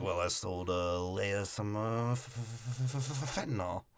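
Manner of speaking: soft, low, halting stammer; stammering mumble. Retold or imitated. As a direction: stumble over the word fentanyl quietly. Del Toro character stammering quietly.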